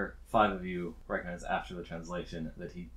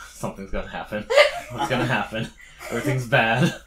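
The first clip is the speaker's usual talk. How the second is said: mock foreboding; 5 semitones higher, 9 dB louder, faster than usual. GM mocks party foreboding.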